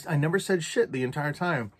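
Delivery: normal talking voice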